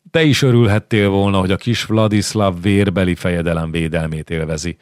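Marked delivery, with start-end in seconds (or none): none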